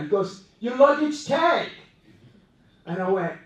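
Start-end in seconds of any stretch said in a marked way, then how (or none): 0.6-1.7 voiced stranger. Stranger answers, voiced figure.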